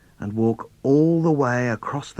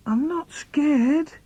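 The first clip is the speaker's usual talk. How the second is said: high, slow, shaky-brave reassurance; child talks himself brave in the dark; falsely brave; 14 semitones higher, slower than usual. Acted. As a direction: insist you're not scared while clearly scared. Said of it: "So I have to say".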